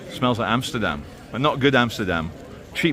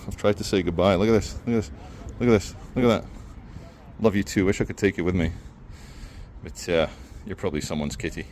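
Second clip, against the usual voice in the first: tender cat talk; 6 semitones lower, 5 dB quieter than usual. Real, not acted. Petting and cooing at cat.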